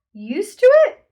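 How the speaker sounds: loud high incredulous exclaim. A narrator's reading. Calvin exclaims at the beasts in loud disbelief. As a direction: throw the words back in disbelief. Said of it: "Calvin exclaimed".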